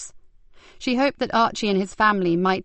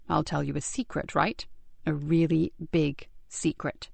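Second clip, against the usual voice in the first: hushed; 3 semitones lower, 6 dB quieter than usual. Acted. Promising a secret to child.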